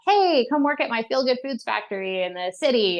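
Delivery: lightly voiced slick salesman fox; a hint of a smooth city recruiter; city fox pitch. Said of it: "this fox from the city comes in and he's like".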